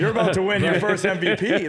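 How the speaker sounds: words bubbling with laughter, voice lifted; laughing through it. Retold or imitated. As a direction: laugh while delivering the punchline. Retelling his own joking reply.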